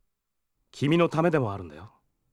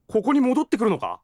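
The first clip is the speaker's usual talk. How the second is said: higher, louder startled question; 7 semitones higher, 4 dB louder than usual. Real, not acted. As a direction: caught off guard, alarmed. Learns hunter returns here.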